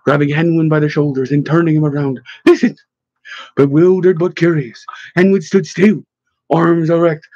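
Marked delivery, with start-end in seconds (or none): 2.4-2.8 sharp high-pitched command; ordered. A narrator's reading. Pushiami ordering Henwin to listen to a sound, ordering. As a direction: order it urgently, pitched well up. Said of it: "Pushiami ordered".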